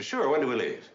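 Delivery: high, loud playful sarcasm; friendly sarcasm. Acted. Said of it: "Friendly sarcasm".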